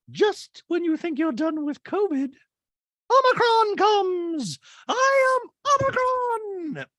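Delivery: serious, very high-pitched proclamation; Dorit. Acted. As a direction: announce it slow and loud, mock-grave falsetto. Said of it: "does her serious Dorit voice".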